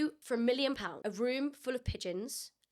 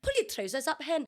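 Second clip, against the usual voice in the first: Scottish character telling someone off; Scottish accent with amused edge; 4 semitones higher than usual. Shown as a demonstration. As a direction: put on a comic Scottish voice. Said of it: "It's a character".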